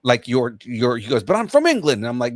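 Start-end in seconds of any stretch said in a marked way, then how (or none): 1.2-2.0 high, loud mock English accent; hammed-up English accent, pitched way up; fake English accent. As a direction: imitate a badly faked English accent, indignant. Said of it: "you sound like you're faking it".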